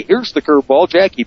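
ordinary talk, nothing marked

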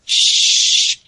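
long, loud shush; drawn-out unvoiced shushing; hushing shh. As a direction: hush the listener. Voiced hushing in a read tweet.

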